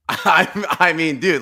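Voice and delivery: laughing. Real, not acted. Laughing at the viral video.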